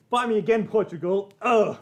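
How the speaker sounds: loud, high-pitched taunt ending in a grunt; mock challenge. Streamer taunting Portugal next, loud mock challenge.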